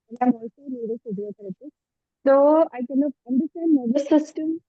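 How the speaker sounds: muffled voice cutting out. Real, not acted. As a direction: talk through a covered mic. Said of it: "your voice is again".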